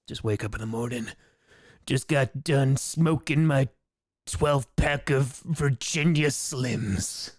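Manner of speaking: very slow, gravelly smoker's growl; gravelly manly voice. Pretend. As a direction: drag it out like a hardened chain-smoker. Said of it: "I finally have a gravelly, manly voice".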